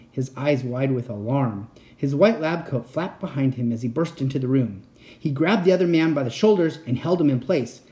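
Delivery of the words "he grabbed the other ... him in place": ordinary delivery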